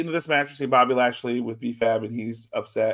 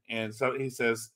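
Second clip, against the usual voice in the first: ordinary talk, nothing marked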